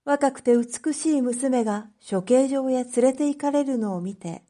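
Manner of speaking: usual speaking voice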